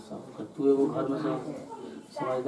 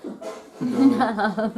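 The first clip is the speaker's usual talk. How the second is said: laughing coax; 9 semitones higher, 5 dB louder than usual. Acted. Husband coaxes a smile, laughing.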